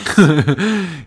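loud, high laugh; burst of laughter. Real, not acted. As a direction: crack up at the absurd story. Reacts to the fake kidnapping.

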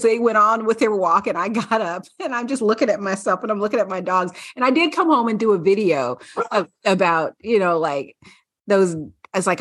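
ordinary delivery

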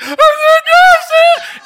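very high, loud, drawn-out fake wail; hammed-up sobbing squeal; mock blubbering. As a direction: imitate yourself bawling uncontrollably. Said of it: "I'm just blubbering".

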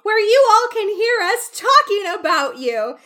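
high, loud gushing chatterbox voice; hammed-up Miss Bates calling out the window.

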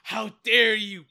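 loud mock indignation; hammed-up outraged snap; pissed Sango. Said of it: "all pissed".

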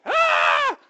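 high, loud startled shriek; panicked yelp. Retold or imitated. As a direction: scream like a man ducking a baseball.

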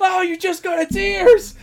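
high, loud, slow horrified cry; hammed-up panic over the rabbit; mock horrified shriek. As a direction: shriek it in comic horror.

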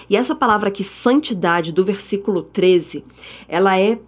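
ordinary talk

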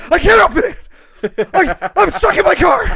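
high, loud, laughing panic at being trapped; mock panic. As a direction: squeal that you're stuck, half laughing. Stuck in car, panicking.

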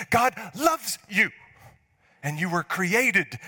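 high, loud, impassioned preaching; full-force preaching. Real, not acted. Pastor proclaiming God's overflowing love, full-force preaching. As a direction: preach it out with fire.

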